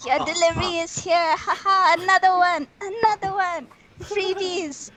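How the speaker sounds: high, playful sing-out; gleeful mock taunt. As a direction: keep calling out blows like deliveries. Repeats taunt while elbowing the wrestler.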